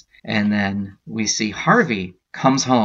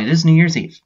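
announcing; 7 semitones higher, 6 dB louder, faster than usual. Acted. Dramatic chapter announcement.

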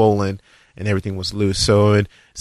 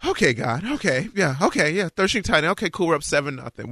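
high, strained okays; mock-nervous agreement; forced acceptance; 7 semitones higher than usual. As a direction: talk yourself into it, pitched up.

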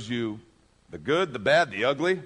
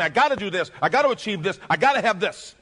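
high, loud, urgent list; acting out frantic worldly pressure; driven, demanding; 6 semitones higher, 7 dB louder than usual. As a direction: push each demand harder, as the pressured striver.

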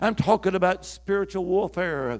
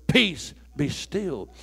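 slow commanding calm; 2 semitones lower, much slower than usual. Shown as a demonstration. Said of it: "Or in other words".

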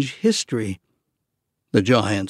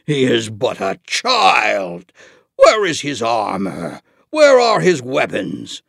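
a hint of a sneering giant; scornful giant voice; 4 dB louder than usual.

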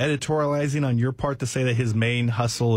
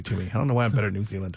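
normal talking voice

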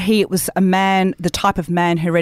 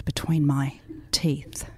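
whisper; 3 semitones lower, 7 dB quieter, slower than usual. Acted. Sultry reading of sex scene.

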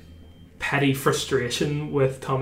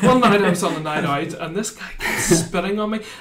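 lightly voiced player telling an anecdote; playful imitation, rising to laughter; mock Tommy Bowe retelling; 6 semitones higher, 3 dB louder, faster than usual. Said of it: "Do you think he uses you as a story".

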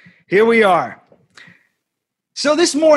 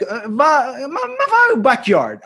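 high-pitched mock Italian grandparent talk; Italian-American imitation; 8 semitones higher, slower than usual. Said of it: "things like talking in Italian".

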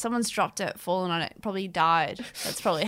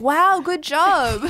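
much higher, louder, very slow gushing praise with a laugh; mock patronising praise; 8 semitones higher, 8 dB louder, much slower than usual. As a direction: gush over it like a condescending teacher. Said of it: "And they're like".

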